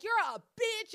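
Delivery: high, loud sing-song insult; mock insult. Cousin insulting her during the fight, high loud taunt. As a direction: fling it like a bratty thirteen-year-old. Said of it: "She was like".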